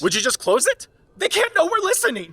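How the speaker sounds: very high, very loud panicked shriek; terrified scream. Acted. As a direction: scream it, fear breaking through. Panicked, escalating plea.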